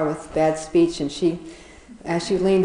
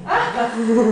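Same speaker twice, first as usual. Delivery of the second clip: louder, higher, real burst of laughter; laughing; 5 semitones higher, 4 dB louder than usual. Real, not acted. Storyteller voicing the confident elephant, breaking up laughing. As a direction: crack up before carrying on.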